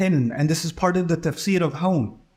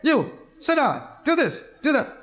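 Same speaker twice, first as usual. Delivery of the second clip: higher, commanding orders to a pupil; strict teacher giving orders; 7 semitones higher than usual. Retold or imitated. Teacher ordering a misbehaving pupil to sit down, commanding. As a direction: order the kid around like a stern teacher. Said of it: "the teacher says".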